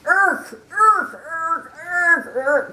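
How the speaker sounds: high, loud retching irks; gagging elephant; sick noises. Acted. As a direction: retch comically. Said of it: "sick to your stomach type words".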